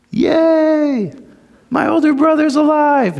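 high, bright mock cheer, plainly wrong. Preacher mocking the brother's imagined glee, high mock cheer. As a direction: fake delight, set up to be corrected.

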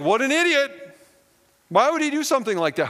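high, loud scoffing at a fool; mock him for being so foolish; scornful disbelief. Congregation scoffing at the king, high and loud disbelief. Mocking the king's foolish choice.

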